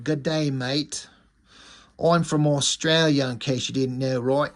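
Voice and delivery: bad Australian accent slipping into English; put-on Australian that turns English by the end; shaky Australian accent. Night Saber saying he's from Australia, botched Australian accent. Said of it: "I suddenly turned into an English accent".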